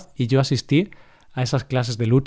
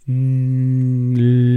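ordinary delivery

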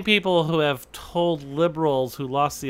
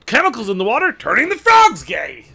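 higher, loud ranting conspiracy host, slowing at the end; Alex Jones impression; 7 semitones higher, 6 dB louder than usual. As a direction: rant about the frogs like a shouting radio host. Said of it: "I really like your Alex Jones".

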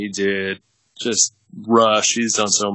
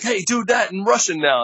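higher-pitched mimicry of a casting director; mock eager request; 10 semitones higher than usual. Casting people goading the struggling auditioner, mock eager. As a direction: pitch it up, playfully egging someone on.

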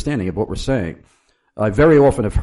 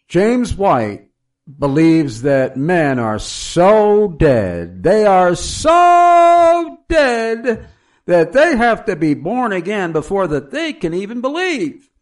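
mock outraged debater, high and loud, drawing out SO DEAD; hammed-up horror in a raised, incredulous debating voice; horrified opponent; 9 semitones higher, 5 dB louder, slower than usual. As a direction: imitate an appalled fundamentalist, building to a drawn-out high cry. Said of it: "recoiling in horror".

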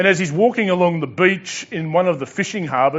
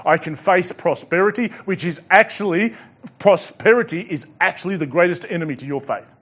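ordinary delivery

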